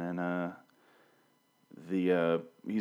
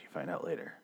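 unvoiced, much softer aside; whispered aside; 6 semitones lower, 11 dB quieter, faster than usual. Real, not acted. Reviewer whispering a spoiler hint. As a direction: whisper it like a secret.

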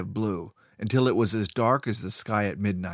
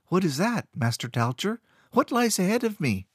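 put-on much higher, curious child; young boy voice; 10 semitones higher than usual. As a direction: ask eagerly like a boy. Boy asks eagerly.

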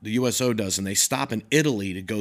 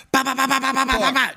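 high mouth-made machine gun bursts; gun noises; 12 semitones higher, 4 dB louder, faster than usual. Retold or imitated. Toby firing the fifty cal, high gunfire noises.

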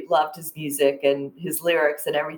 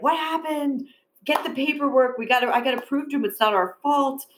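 panicked call; 7 semitones higher than usual. Retold or imitated. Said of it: "My knees are shaking".

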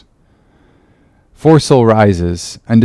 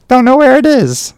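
sulky and sleepy; 3 dB louder than usual. Acted. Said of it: "Pip, sulky and sleepy".

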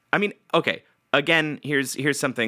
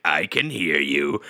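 menacing villain; 7 semitones lower, slower than usual. Retold or imitated. Villain Venger answers radio.

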